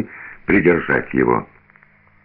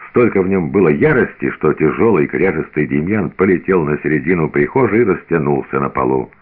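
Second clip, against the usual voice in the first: ordinary delivery, nothing marked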